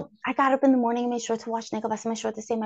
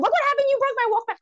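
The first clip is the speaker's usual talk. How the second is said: high, fast accusing yell; retold yell; 14 semitones higher, 3 dB louder, faster than usual. Teen yelling at brother over broken Walkman, high and fast. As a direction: blame him at the top of the voice. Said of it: "I'm yelling at him".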